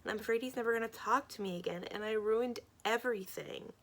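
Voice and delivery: ordinary delivery, nothing marked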